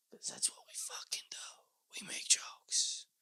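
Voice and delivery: whispered, very soft; barely voiced, trailing whisper; hushed aside. Real, not acted. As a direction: drop to a breath after the laugh. Trails off after laughing at joke.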